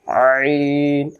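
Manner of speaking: long আইন with the opening wrung from the throat; pressed throat Ain. Shown as a demonstration. Teacher shows the sound that should come, throat-squeezed আইন. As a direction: start আইন as if dislodging a fishbone, drawn out. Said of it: "এইরকম ধরনের উচ্চারণটা আসবে".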